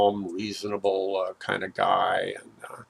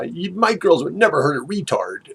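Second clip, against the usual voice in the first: defensive yell; 7 semitones higher, 5 dB louder than usual. Retold or imitated. Neighbor mom yelling back.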